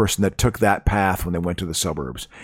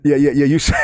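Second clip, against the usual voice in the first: ordinary talk, nothing marked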